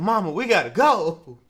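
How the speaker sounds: loud panicky plea; panicked son. Retold or imitated. Son begging his mother to leave, loud panicky plea. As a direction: blurt it out, desperate to leave.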